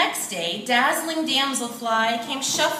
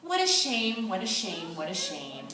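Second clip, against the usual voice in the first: fading sad sigh; 4 semitones lower, 5 dB quieter than usual. A narrator's reading. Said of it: "shuffled away, saying".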